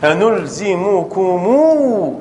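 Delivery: أنلزمكمو drawn out slowly to show the added و; slow breakdown. Shown as a demonstration. Teacher showing the base form, slowly stretched أنلزمكمو. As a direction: stretch أنلزمكمو to its last vowel. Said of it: "هذا الأصل".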